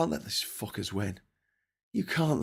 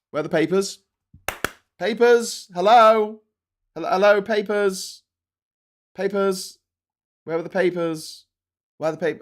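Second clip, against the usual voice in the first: high, loud calling out; playful high "Hello? Papers?"; 10 semitones higher, 10 dB louder than usual. Real, not acted. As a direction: call out as if searching the room.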